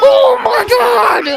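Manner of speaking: long, high, drawn-out shout; alarmed scream. Real, not acted. Player shocked by the game's worm, screaming.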